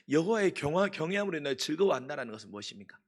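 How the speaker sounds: normal talking voice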